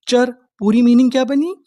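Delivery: usual speaking voice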